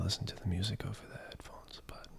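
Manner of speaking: soft late-night whisper. Real, not acted. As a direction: whisper so nobody wakes up. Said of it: "I'm trying to whisper".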